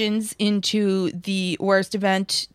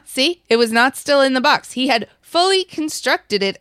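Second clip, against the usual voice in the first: higher and louder, gleeful; excited; 5 semitones higher, 4 dB louder than usual. Real, not acted. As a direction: pounce on the detail triumphantly. Excited mocking commentary.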